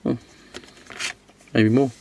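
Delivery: ordinary talk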